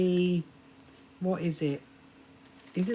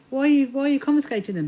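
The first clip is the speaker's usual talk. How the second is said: higher, louder and faster, mock-indignant; retold indignant protest; 7 semitones higher, 7 dB louder, faster than usual. To the airport officer taking her slippers, imitating indignant protest. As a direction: replay your outraged question at security.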